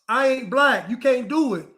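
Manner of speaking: normal talking voice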